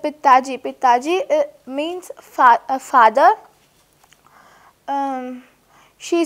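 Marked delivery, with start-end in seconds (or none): none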